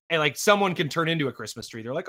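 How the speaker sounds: ordinary delivery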